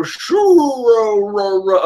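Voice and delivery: slow, drawn-out Caesar laugh. Retold or imitated. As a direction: stretch the cackle out slowly. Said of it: "I can't do the Caesar voice".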